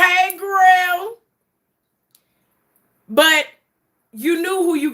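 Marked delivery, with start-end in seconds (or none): 0.0-1.2 high, drawn-out friendly hail; drawn-out greeting. Real, not acted. Host greeting a fan's wife, drawn-out hello. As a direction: sing out the hello.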